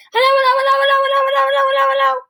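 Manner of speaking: very high, loud singsong rush; rapid-fire hellos. A narrator's reading. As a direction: rattle them off as fast as possible. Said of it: "as fast as she could".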